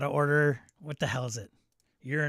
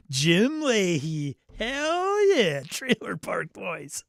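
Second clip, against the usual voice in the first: put-on Trailer Park Boys intro; 6 semitones higher, 5 dB louder, much slower than usual. Imitating the show's intro.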